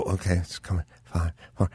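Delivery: hushed countdown; quiet countdown. Retold or imitated. Host retelling a countdown to the caller, hushed. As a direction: count down softly, waiting. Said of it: "I'd look at the clock and I'd go".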